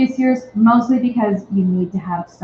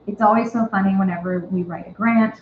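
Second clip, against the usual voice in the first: usual speaking voice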